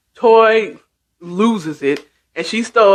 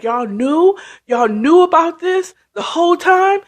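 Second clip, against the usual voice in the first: rising, higher-pitched disbelief; incredulous questioning; 9 semitones higher than usual. Retold or imitated. Toy asking if they all knew, incredulous. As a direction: act stunned, lifting the repeat higher.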